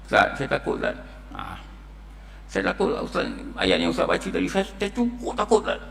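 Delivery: half-whispered, on the edge of tears; a scared man confessing to the preacher, softly and tearfully. Retold or imitated. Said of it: "dia menangis".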